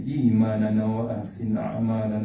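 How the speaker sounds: low, rapid Arabic supplication; prayer recitation. Real, not acted. Preacher leading congregation in closing doa, prayer recitation. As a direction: recite the prayer low and steady, in an even chant.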